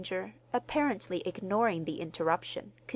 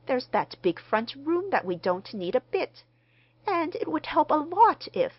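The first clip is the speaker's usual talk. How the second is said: rising, louder, eager husband; hopeful pleading, building higher; eager persuasion; 8 semitones higher, 5 dB louder than usual. A narrator's reading. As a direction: press the case keenly until cut off. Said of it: "interposed her husband eagerly".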